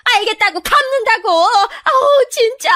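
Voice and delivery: very high, frantic giving-in; flustered, fed-up high-pitched yelp; exasperated. Acted. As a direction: shriek it in frustration. Cornered in-law gives in.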